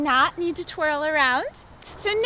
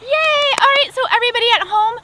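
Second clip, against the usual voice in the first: excited; 4 semitones higher, 6 dB louder, faster than usual. Real, not acted. Cheering kids on to join.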